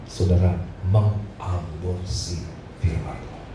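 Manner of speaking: low, slow and weighty; grave warning. Retold or imitated. Preacher quotes his pastor warning the congregation, grave warning. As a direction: draw out each word as a solemn warning.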